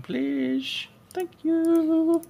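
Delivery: squeaky falsetto thanks; sugary high-pitched please and thank you. Pretend. Sweet-talking the unpacker.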